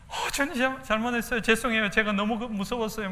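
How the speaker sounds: high, pleading stammered apology; disciple's timid apology. Pretend. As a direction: fumble for words like a guilty disciple. 제자의 변명 상상. (주님께 쭈뼛대며 변명하는 제자)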